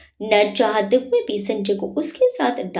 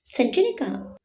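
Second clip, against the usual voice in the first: ordinary delivery, nothing marked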